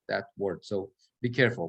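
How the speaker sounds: normal talking voice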